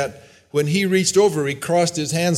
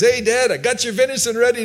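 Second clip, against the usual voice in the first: lightly acted son, voice pitched up; eager son; 7 semitones higher, faster than usual. Esau announcing the venison to his blind father, lightly voiced eager son. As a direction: call out to Dad in a higher voice.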